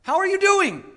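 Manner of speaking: very loud, high, stretched-out preaching; full-force pulpit shout; impassioned yelling. Real, not acted. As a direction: yell it out to the church with fervor. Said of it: "should I be yelling like that".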